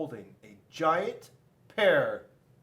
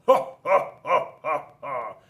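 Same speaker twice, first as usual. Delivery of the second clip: loud mocking ha-ha-ha; mocking laugh; 10 dB louder than usual. A narrator's reading. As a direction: laugh at the clothespin, loud then fading. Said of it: "And Rock just laughed".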